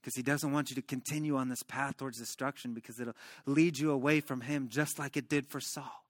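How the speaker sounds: low, soft reassurance; acted tender pastoral comfort; comforting friend. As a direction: console gently, as if holding a friend. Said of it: "He's embracing him".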